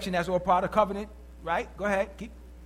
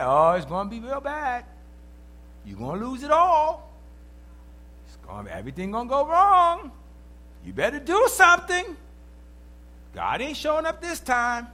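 lightly voiced nagging devil, rising higher; mock devil's taunt; 5 semitones higher, 5 dB louder than usual. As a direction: tease like a devil whispering doom. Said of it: "the devil's yelling in your ear".

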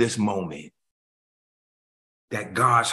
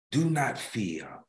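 slow, softer repeating of the verse; soft reprise; 5 semitones lower, 5 dB quieter than usual. Acted. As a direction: let it fall gently.

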